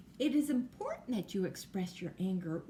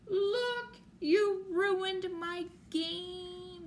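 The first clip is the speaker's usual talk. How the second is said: much higher, louder, slow accusing cry; upset child accusing; 10 semitones higher, 5 dB louder, much slower than usual. Acted. Girl to friend who ruined her game, loud high accusing wail.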